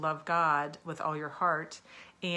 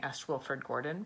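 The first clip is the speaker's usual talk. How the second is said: ordinary delivery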